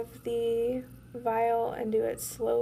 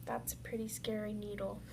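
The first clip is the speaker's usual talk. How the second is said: whispering; 9 dB quieter than usual. Real, not acted. Aside about the needle.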